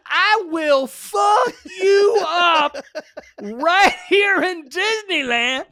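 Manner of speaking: high, loud, slow furious threat; mother's threat, screamed; repeated scream. Retold or imitated. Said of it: "she screams at him".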